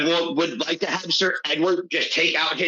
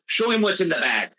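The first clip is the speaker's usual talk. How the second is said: ordinary talk, nothing marked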